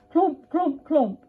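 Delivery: high punchy clomps; clomping stick. Acted. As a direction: knock each clomp like a wooden stick. Stick sound effect.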